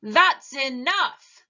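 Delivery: slow, loud stern shout; heroic shout. A narrator's reading. Soup Potato confronting the pea, slow loud shout. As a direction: stop the villain with a firm loud call. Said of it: "shouted Soup Potato".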